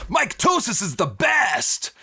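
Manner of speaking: high, loud villain brag; boastful shout. Acted. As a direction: yell it like a proud brute. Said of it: "Mycotosis looks down at you and goes".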